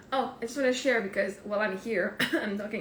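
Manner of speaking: ordinary talk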